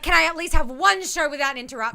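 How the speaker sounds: exasperated Alexa. Retold or imitated. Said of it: "She's like".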